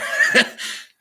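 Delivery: loud burst of laughter; laughing. Real, not acted. Laughs at what is read.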